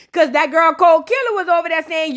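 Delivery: normal talking voice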